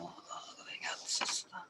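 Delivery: slow annoyed whisper. Real, not acted. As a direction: whisper it, searching for something. Searching, aside during live call.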